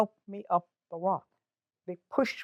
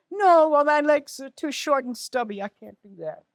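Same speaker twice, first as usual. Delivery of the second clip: protesting; 6 semitones higher than usual. Retold or imitated. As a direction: wail the refusal, then let it drop. Acts out second refusal to helper.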